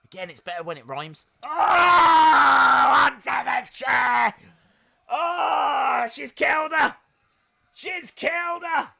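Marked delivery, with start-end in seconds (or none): 1.4-8.9 high, loud, slow yell of disbelief; real stunned commentary shout, much higher and louder; shocked excited shout. Real, not acted. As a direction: scream that the wrestler is finished. Shocked by chair spot.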